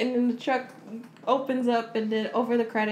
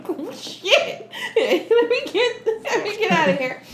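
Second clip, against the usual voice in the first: high-pitched, laughing retreat; laughing mock panic; 10 semitones higher, 5 dB louder than usual. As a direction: play the spooked priest through laughter. Retells the priest fleeing, laughing.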